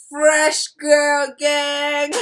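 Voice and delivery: loud, high, long-drawn cheer; hyped slogan shout. Pretend. Vlogger cheering her fresh face, playful drawn-out shout. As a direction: stretch each word out like a gang chant.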